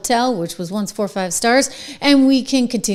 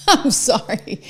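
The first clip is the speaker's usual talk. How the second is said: laughing; slower than usual. Real, not acted. Laughing at the clip.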